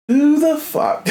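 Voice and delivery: very high indignant squeal; high-pitched who the fuck; exasperated outrage. Real, not acted. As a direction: throw it up high in disbelief. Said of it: "It still makes me angry".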